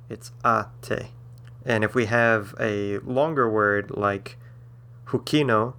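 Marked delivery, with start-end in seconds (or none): 0.4-1.1 ate as AH-tay, first syllable stressed. Shown as a demonstration. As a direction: punch the opening vowel of ate. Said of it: "it falls on the a part".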